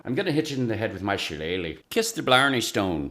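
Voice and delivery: fast, lightly put-on Irish accent; a quick hammy stab at an Irish brogue; mock Irish accent. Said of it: "My Irish isn't really that good".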